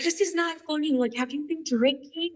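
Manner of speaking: high-pitched imitation of an alarmed mother; disbelieving mom. Retold mom's disbelief on the phone.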